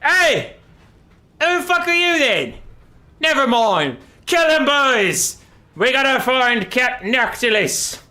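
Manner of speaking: high, loud battle cry; rowdy pirate yell. Retold or imitated. Aranessa orders her crew to attack, loud and high. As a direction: bark orders at the boys. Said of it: "she's like".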